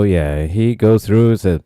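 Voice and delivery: usual speaking voice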